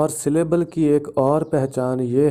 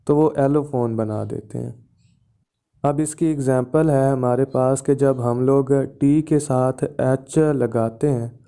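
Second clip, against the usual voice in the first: normal talking voice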